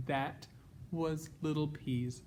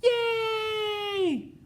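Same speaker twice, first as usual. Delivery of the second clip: high, loud cheer; 7 dB louder than usual. Acted. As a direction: cheer like a kid. Happy ending cheer.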